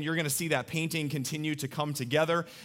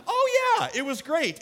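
very high, loud squeal of joy; mock child exclaiming; delighted kid; 12 semitones higher, 4 dB louder than usual. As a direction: gush like a thrilled four-year-old. Son delighted.

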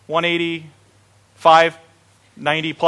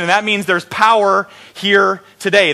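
normal talking voice

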